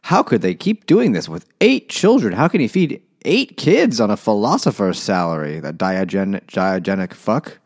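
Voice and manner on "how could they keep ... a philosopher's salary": high, loud, disbelieving gasp of a question; mock the scandalised guests; incredulous guests. House guests gossiping about the Ramseys, high and incredulous. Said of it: "They're just like".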